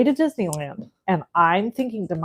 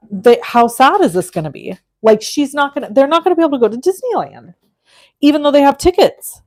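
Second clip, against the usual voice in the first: higher, louder, fast panicked rush; freaking out; 7 semitones higher, 6 dB louder than usual. Retold or imitated. Retelling to viewers how she panicked over the wife's missing park reservations, higher and rushed. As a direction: spiral into retold panic about the kids missing Disneyland. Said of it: "So I'm freaking out".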